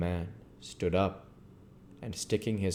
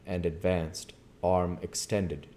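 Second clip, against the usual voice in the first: ordinary delivery, nothing marked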